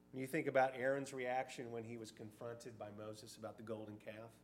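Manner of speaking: ordinary talk, nothing marked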